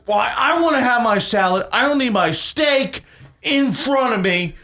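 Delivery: high-pitched indignant customer; retold yelling. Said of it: "He's yelling this at me".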